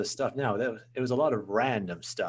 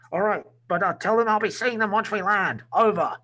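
higher, louder pilot calling in on the radio; pilot over the radio; 10 semitones higher, 5 dB louder than usual. Acted. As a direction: call it in like a fighter pilot mid-flight. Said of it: "the majority of acting in my films".

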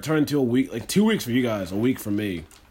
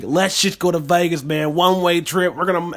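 put-on Southern accent; 6 semitones higher, 4 dB louder than usual. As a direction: put on a hammed-up Southern good-ol'-boy voice. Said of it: "I don't know why I did Southern accent".

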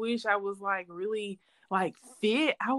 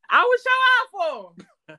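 excited boast; 9 semitones higher, 5 dB louder than usual. Acted. Boasting about cheering husband.

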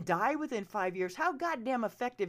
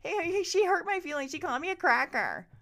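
mocking whiners; 8 semitones higher, 4 dB louder than usual. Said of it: "slip back into".

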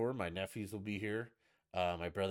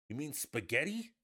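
higher, baffled correction of SPAGHETTI; incredulous; 6 semitones higher, 3 dB louder than usual. Acted. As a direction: question it in disbelief. Baffled reply to accented word.

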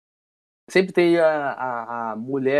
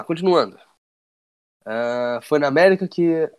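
normal talking voice

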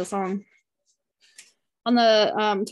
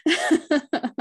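choking up; 7 semitones higher than usual. Real, not acted. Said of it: "I'm gonna start crying now".

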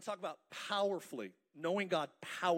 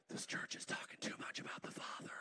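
whisper; 8 dB quieter than usual. Pretend. Mock complaint of jealousy.

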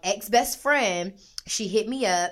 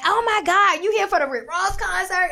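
high, louder starstruck question; excited fan; 10 semitones higher, 5 dB louder than usual. Retold or imitated. Asking Andre about the concert, high excited. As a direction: gush at him.

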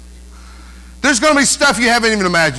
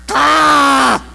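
short, high "Ah!" of exasperation; a sudden fed-up yelp; annoyed shout; 4 semitones higher, 3 dB louder than usual. Retold or imitated. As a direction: blurt it out like you've had enough. Annoyed listener's outburst.

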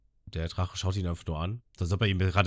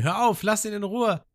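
flehende Abyss; 3 dB louder than usual. Retold or imitated. Abyss zieht Ruffy weg.

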